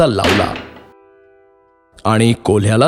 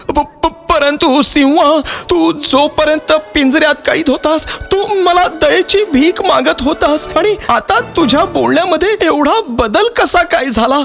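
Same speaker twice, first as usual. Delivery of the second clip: very high, stammering scared voice; frightened. A narrator's reading. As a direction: stammer the first word, pitched way up in fright. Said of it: "तो माणूस घाबरत बोलला". (घाबरलेला, खूप उंच आवाज)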